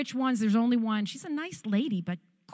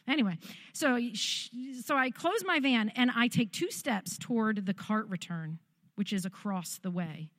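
ordinary talk, nothing marked

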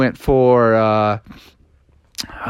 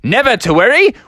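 wizard Merlin; 9 semitones higher, 4 dB louder than usual. Acted. Merlin reassures the players.

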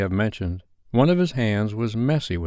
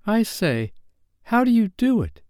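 put-on high-pitched boyish question; curious child's voice, pitched high; eager boy; 13 semitones higher than usual. A narrator's reading. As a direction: ask up high, keen to learn. John asks Peter how to fly.

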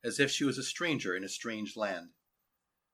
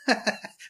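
amused chuckle; 9 semitones higher than usual. A narrator's reading. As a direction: chuckle at a child's tale. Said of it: "The tall man rubbed her head".

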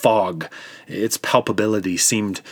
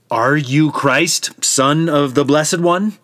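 slow insistent question; 6 semitones higher, 6 dB louder, slower than usual. A narrator's reading. High priest interrogating Jesus.

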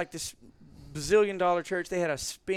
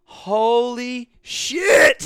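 much louder, higher and drawn-out stunned cry; overjoyed shout; 9 semitones higher, 15 dB louder, much slower than usual. Retold or imitated. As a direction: burst out in disbelieving joy, slowly. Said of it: "And we were like".

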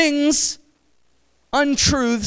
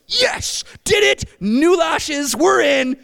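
triumphant cheer; 4 semitones higher, slower than usual. Retold or imitated. Preacher voicing a girl thrilled her lashes got noticed, triumphant cheer. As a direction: celebrate like you just won.

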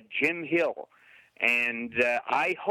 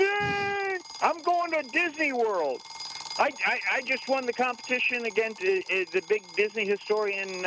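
much higher, gleeful victory cheer; excited cheering; 7 semitones higher than usual. Real, not acted. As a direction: gloat like a game show winner. Celebrating winning the competition.

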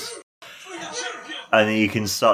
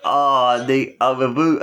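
ordinary delivery

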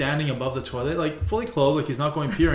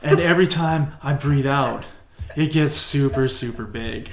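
stoned slow drawl; 4 dB louder, slower than usual. Retold or imitated. Stoned friend explains the plunger.

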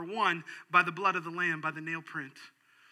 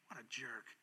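what a jerk, dropped low and soft; a soft scornful aside; low disgusted mutter; 7 semitones lower, 15 dB quieter than usual. Real, not acted. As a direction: shake your head and mutter it. Repeated phrase dropped low.

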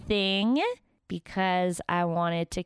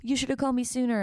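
higher, whining mock complaint; imitating an anxious, nagging self; whiny impatience; 6 semitones higher, much faster than usual. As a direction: fret in a needy, high-pitched whine. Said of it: "But before, I would be like".

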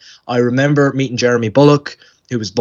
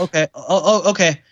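small, higher, halting voice; mock meek kid giving in; imitating flustered child; 5 semitones higher than usual. Brushed-off child accepts the actor's answer, meekly flustered.

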